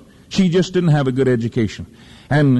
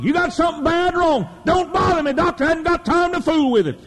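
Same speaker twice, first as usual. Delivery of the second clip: much higher, fervent preacher cry; impatient brush-off; 14 semitones higher than usual. Pretend. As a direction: wave the doctor off, no time for it. Preacher voices brushing off doctor.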